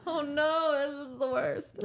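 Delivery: amused mock horror; high laughing dismay. Real, not acted. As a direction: whine it through laughter. Reacting to Cassian leaving.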